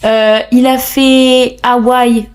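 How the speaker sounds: Spanish song title in a knowingly bad accent; clumsy Spanish accent. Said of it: "mon accent est effroyable".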